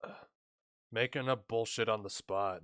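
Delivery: ordinary talk, nothing marked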